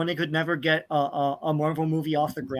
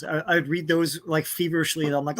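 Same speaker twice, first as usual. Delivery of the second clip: normal talking voice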